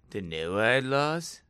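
low, slow stoner question; drawn-out dazed stoner, rising. Acted. Now hooked on Noahide laws, stoner drawl. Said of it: "I know I sound like a stoner".

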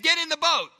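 loud preaching shout; shouted command. Shown as a demonstration. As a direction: bellow it like God giving orders. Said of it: "What do you think God meant".

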